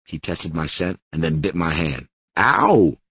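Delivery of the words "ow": sharp loud ow of pain; pained yelp. Acted. Bitten by the dog, a sharp loud yelp. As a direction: yelp as the teeth sink in. Dog bites narrator's hand.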